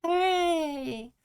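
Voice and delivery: playful celebratory shout; cheer. Pretend. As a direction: cheer it out like the emote. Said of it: "I can do".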